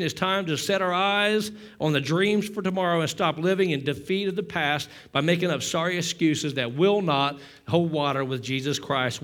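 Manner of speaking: ordinary talk, nothing marked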